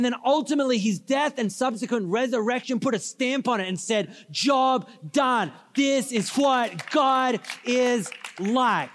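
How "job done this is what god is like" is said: slow, word-by-word proclamation, pitched up; emphatic declaration. Acted. Preacher voicing what the resurrection declared, emphatic declaration. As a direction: stamp each word like a verdict. Preacher voices the stamp of approval.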